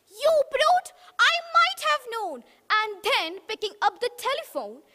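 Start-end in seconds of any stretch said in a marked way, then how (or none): 0.1-2.4 much higher, louder pig cry; outraged little pig. Acted. Pig crying at the wolf, high and loud. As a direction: squeal out the accusation. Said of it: "The pig cried".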